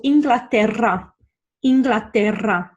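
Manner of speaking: Inglaterra with a long trilled RR; Spanish trill on the RR of Inglaterra; rolled R. Shown as a demonstration. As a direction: roll the double R in Inglaterra. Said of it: "it's rolled around your mouth".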